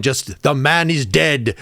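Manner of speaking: drawn-out South African-song lament; slow, solemn. Retold or imitated. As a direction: stretch out the man is dead slowly. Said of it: "It's gonna be very slow".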